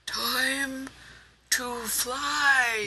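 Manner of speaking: high, stretched-out call; old man's rallying call. A narrator's reading. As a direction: call it out high with the last strength. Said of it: "summoned up the last of his strength".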